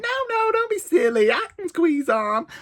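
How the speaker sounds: very high, brushing-off American lady; hammed-up American tourist, waving off objections; mock American accent. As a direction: squeal it in a put-on American accent. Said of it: "And she's like".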